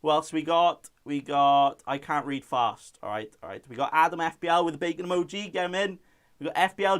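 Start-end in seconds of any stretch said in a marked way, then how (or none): none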